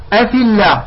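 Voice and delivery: "الله" with thin "llaah" after "أفي"; light lam. Shown as a demonstration. Teacher restores the correct form, thin "الله". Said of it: "قبل اللام مكسور".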